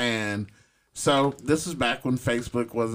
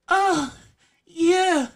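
mock moaning woman; 6 dB louder, slower than usual. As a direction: ham up a woman mid-sex calling to the camera. Woman moaning at him while filmed.

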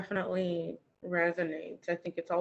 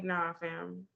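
dismissive brush-off; 2 semitones lower, 9 dB quieter than usual. Pretend. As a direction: wave it off quietly. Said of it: "I'd be like".

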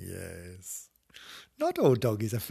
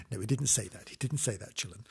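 softer quiet take-back; soft sheepish aside; hushed correction; 3 semitones lower, 11 dB quieter, faster than usual. Real, not acted. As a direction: murmur the retraction.

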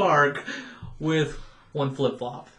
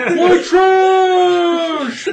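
high, loud shrieked complaint; laughing mock scream; 13 dB louder, much slower than usual. Retold scream at coworker.

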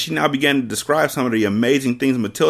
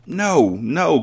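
higher, urgent no no; cried; 7 semitones higher than usual. Acted. Miss Honey denying the accusation, higher and urgent. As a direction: plead quickly. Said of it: "cried Miss Honey".

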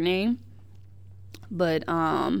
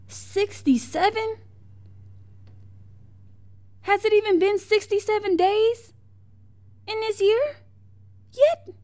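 incredulous; 14 semitones higher, 4 dB louder than usual. Real, not acted. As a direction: ask it in high, stunned disbelief. Disbelief at the shootings tally.

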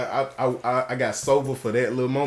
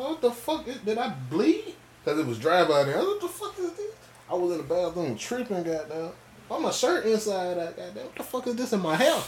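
high, bewildered mock panic; confused panic; 7 semitones higher than usual. Retelling his hungover morning to friends, high confused alarm. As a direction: replay waking up baffled, pitch way up. Waking up confused after drinking.

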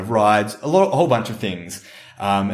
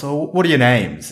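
usual speaking voice

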